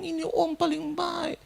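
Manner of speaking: slow, high repeated mourning refrain; imitated tearful lament. Stranger repeating praise of the dead, imitated slow high weeping. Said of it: "Dia ucapkan itu berulang-ulang".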